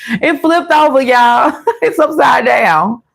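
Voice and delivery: high, laughing exclaim as the card flips; laughing excitement. Real, not acted. Reader to viewers about the flipped card, laughing excitedly. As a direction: squeal it out with a laugh, delighted.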